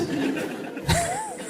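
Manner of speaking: high breathy giggle; a quick giggle escaping; laugh. Real, not acted. Host giggling at the lawyer's question, high and breathy.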